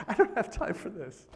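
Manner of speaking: playfully exasperated; annoyed. Acted. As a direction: complain at the alarm, half amused. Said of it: "I'm annoyed at the alarm".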